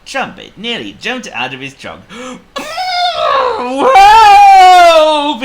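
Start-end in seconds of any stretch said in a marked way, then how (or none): 2.1-4.0 very high, loud frightened gasp; terrified shriek. A narrator's reading. Elephant startled by giant mouse. 4.1-5.4 very high, very loud elephant shriek; panicked cry. A narrator's reading. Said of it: "he cried".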